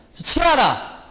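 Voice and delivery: high-pitched Gyeongsang dialect outburst; 경상도 사투리. Acted. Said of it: "우리 경상도 말로". (높게 내지르는 경상도 사투리)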